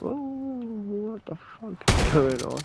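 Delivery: high, drawn-out disbelief; retold "what on earth" astonishment; bewildered. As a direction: stretch it out slowly in high-pitched bafflement.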